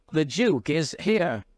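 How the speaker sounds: lightly voiced agitated headmaster. A narrator's reading. Headmaster tells his visitors the Duke has arrived, mildly agitated. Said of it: "turned to us with agitation".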